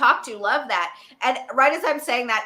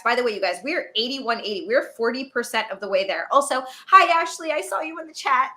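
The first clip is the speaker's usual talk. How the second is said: ordinary talk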